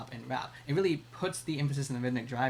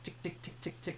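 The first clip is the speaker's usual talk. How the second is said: whispered sharp tick sounds; hi-hat ticks; 4 semitones higher, 10 dB quieter than usual. Shown as a demonstration. Teacher imitates the hi-hat for students, whispered ticks. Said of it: "usually sort of has a tick".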